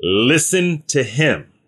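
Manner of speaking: slow, deliberate voice of God the Father; voice from the cloud. Acted. God the Father speaking from the cloud about his son, slow and solemn. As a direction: read it out slowly, word by weighty word. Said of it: "a voice came from the cloud saying".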